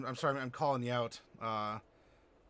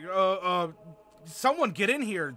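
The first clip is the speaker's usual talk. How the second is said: calling out; 4 semitones higher, 7 dB louder than usual. Retold or imitated. Calling out for help.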